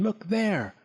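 high-pitched urgent exclamation; insistent excited call; exclaiming. A narrator's reading. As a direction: direct their eyes to it. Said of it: "Clack exclaimed again".

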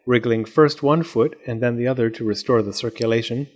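usual speaking voice

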